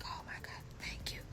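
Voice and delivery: soft unvoiced thank you; relieved whisper. Woman hearing she can walk free, relieved whisper. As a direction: breathe out your relief in a whisper.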